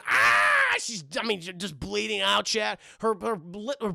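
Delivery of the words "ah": very high, loud shriek; hammed-up piercing scream; mock scream. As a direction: imitate a woman screaming. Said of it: "She's screaming".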